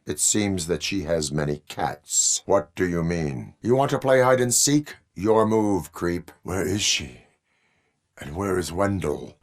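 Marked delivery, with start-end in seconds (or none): none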